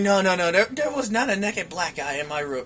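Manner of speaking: low, soft mutter to himself. Retold or imitated. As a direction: mumble it in retold disbelief. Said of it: "All I hear is muttering".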